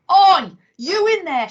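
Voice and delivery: loud high call through the window; yelling. Acted. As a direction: shout to be seen inside. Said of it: "she yelled".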